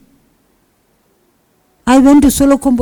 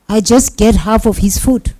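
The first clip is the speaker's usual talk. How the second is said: ordinary talk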